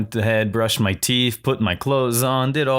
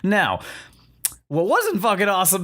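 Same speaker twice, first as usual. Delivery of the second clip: ordinary talk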